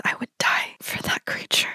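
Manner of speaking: soft unvoiced whisper. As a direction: breathe it out in a whisper. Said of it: "Ophelia just whispers".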